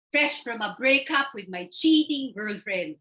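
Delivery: ordinary talk, nothing marked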